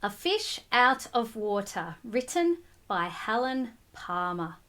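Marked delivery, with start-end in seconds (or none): none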